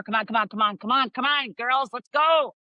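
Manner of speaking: high, hurrying dad calling out; imitated dad's rallying call; impatient dad. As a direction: round up the girls like an impatient father, drawing out the last call. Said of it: "That's what dads do".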